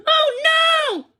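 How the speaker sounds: high, loud cry of alarm. A narrator's reading. Charles as the dog runs off, high alarmed cry. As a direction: yell as the dog escapes. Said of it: "cried Charles".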